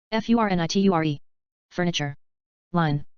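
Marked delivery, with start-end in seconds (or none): 0.1-1.2 quick letter run; brisk recap spelling; fast spelling. Shown as a demonstration. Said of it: "spelling drill, slow then fast".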